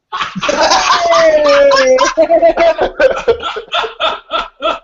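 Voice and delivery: long, high, loud laughing fit; laughing loudly at the offer of a tattoo in a mankini; long loud laughter. Real, not acted.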